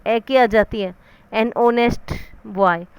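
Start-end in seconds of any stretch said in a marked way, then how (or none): 1.5-2.1 "honest" drawn out slowly, h left silent. Shown as a demonstration. Teacher showing why "an" is used, "honest" with silent h. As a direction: slowly say "honest", starting straight on the vowel. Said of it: "एच silent रहता है".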